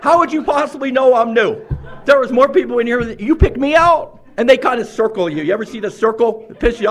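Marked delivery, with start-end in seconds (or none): 0.0-1.6 incredulous disbelief. Retold or imitated. Retold thought, newcomer singled out.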